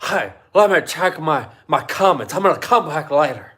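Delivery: a touch of a sneering vlogger imitation; mocking impression. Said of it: "Do you think that's what he sounds like?".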